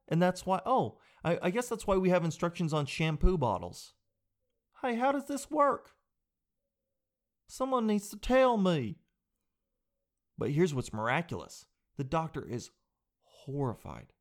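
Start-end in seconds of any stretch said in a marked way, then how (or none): 4.8-9.0 much higher, dopey confused voice; hammed-up confused asker; mock clueless user. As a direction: play a helpless dimwit reading a bottle.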